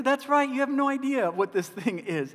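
high, loud, laughing retort; mock-indignant dad. Dad laughing back at his daughter, high mock outrage. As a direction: play up the outrage.

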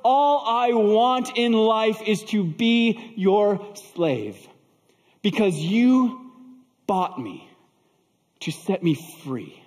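slow, high plea falling away; mildly acted tearful devotion, drawn out; choked through tears. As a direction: plead with overflowing gratitude, then drop to halting words. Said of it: "she choked through her tears".

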